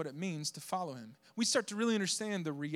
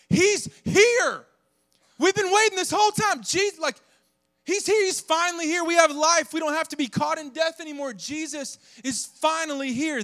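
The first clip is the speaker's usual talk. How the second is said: much higher and louder, bursting with joy; ecstatic joy; 10 semitones higher, 8 dB louder than usual. Pretend. Preacher showing true Christmas joy, high loud ecstatic cry. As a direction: shout it like the long wait is finally over. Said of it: "It's like".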